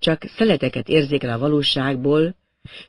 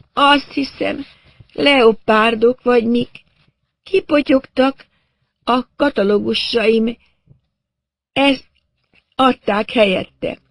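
high, slow, dazed voice; stunned drawl, pitched far up; woman in shock; 9 semitones higher, 3 dB louder, slower than usual. A narrator's reading. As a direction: recount it in halting fragments. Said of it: "rendes sokkot kapott odakint".

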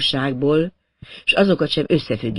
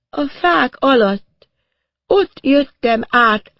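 high, very slow dazed voice; stunned, halting recollection; woman in shock; 10 semitones higher, 4 dB louder, much slower than usual. A narrator's reading. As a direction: drag the words out in a stupor. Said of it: "rendes sokkot kapott odakint".

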